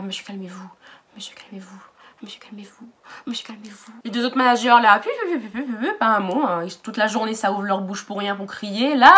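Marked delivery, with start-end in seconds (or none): 0.0-3.9 timid whispered plea of the boss; mocking whisper. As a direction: whisper it weakly, mocking her. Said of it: "Elle est là".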